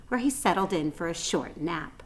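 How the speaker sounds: usual speaking voice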